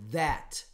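"that" with the tongue between the teeth; voiced th demo. Shown as a demonstration. Teacher demonstrates the voiced TH to students. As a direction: voice the TH in "that" clearly. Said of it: "Por exemplo".

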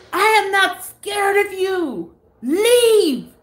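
high, slow, fierce command; commanding exorcism. Retold or imitated. Sister facing the hallway figure, high fierce command.

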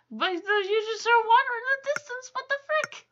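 high, fast, exasperated rant; incredulous, high-pitched complaint; exasperated protest. Real, not acted. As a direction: protest rapidly with the voice climbing high. Protests the game's looping text.

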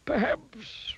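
high-pitched wizard voice; pondering wizard. A narrator's reading. Wizard replying to the begging miser, pondering. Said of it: "replied the wizard".

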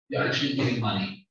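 ordinary talk, nothing marked

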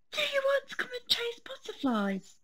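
high quick bunny inviting Fred to play; baby rabbit voice. Acted. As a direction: ask like an eager little rabbit.

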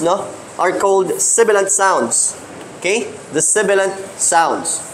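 ordinary talk, nothing marked

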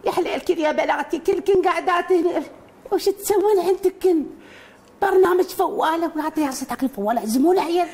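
high old woman cartoon voice; man voicing a high, loud old woman; Umm Khammas voice. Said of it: "أم خماس".

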